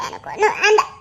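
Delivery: soft giggling no; laughing refusal. Acted. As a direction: refuse while trying not to laugh. Wife refusing, laughing softly. (சிரிப்புடன் மறுப்பு)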